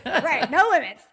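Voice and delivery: laughing while she agrees. Real, not acted. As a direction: say it through a laugh. Laughing at host's joke.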